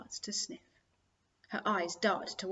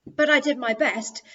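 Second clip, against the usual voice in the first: loud mimicry of his excuse; scornful imitation of a brother; mocking quote; 5 semitones higher, 11 dB louder than usual. A narrator's reading. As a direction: throw his words back at him. Sister mockingly quotes her brother.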